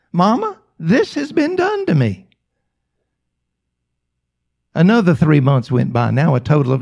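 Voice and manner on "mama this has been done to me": pleading. Retold or imitated. Paralyzed daughter pleading to mother.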